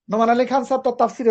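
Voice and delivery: ordinary talk, nothing marked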